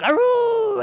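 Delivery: high, loud "aroo" howl; wolf howl. Acted. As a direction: throw your head back and howl. Dog-woman howls at full moon.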